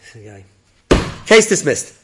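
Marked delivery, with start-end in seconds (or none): 1.3-1.9 loud, high mock proclamation; judge's ruling. Judge ruling for a man without a lawyer, mock booming. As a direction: boom it out like a judge throwing out a case.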